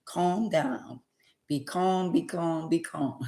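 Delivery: hushed, gentle calm down; soft inner voice of calm. Retold or imitated. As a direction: soothe yourself quietly like a voice from above. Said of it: "heard the little verse from Jehovah".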